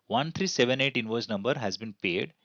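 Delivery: ordinary talk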